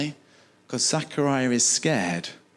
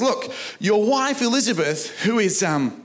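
excited angel; 10 semitones higher than usual. Retold or imitated. Preacher voicing the angel telling Zachariah of a baby, higher and excited. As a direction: announce it like thrilled good news from heaven.

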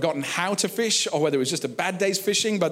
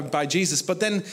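ordinary delivery

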